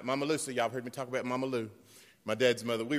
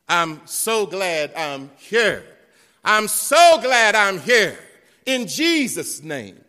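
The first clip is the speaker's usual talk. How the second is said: slow, loud rising praise, much higher; building shout of praise; 8 semitones higher, 16 dB louder, slower than usual. Retold or imitated. Preacher voices Mama Sis praising God in church, building to a shout. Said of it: "She said".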